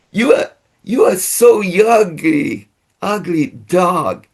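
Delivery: high, cooing sing-song voice to a dog; happy sweet tone. Shown as a demonstration. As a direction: sweet-talk the dog while insulting it. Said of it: "They react depending the tone of my voice". (nada tinggi yang manis dan riang)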